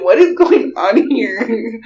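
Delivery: loud, high, drawn-out question; mock bewilderment. Cousin jolted out of her headphones, loudly bewildered. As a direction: demand it slowly in exaggerated confusion.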